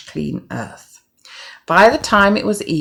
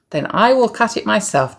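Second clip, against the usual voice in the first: declared; 6 semitones higher than usual. Acted. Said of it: "declared the red".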